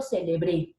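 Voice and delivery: CELEBRÉ with the force on BRÉ; final-syllable stress. Shown as a demonstration. Teacher showing an aguda word, stress on final É. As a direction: land on the last syllable of CELEBRÉ. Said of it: "una palabra aguda".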